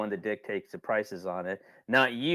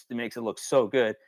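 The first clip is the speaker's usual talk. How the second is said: normal talking voice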